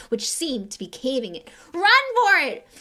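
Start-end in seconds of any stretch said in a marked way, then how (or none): 1.7-2.7 high, loud shout. A narrator's reading. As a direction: yell to flee. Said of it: "Ron shouted".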